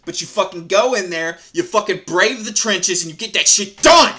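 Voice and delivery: rising to a shout on the last line; getting worked up into a yell; building rant. Real, not acted.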